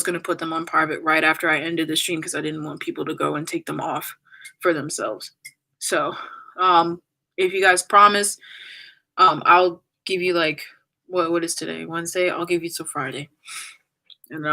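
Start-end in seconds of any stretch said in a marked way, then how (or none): none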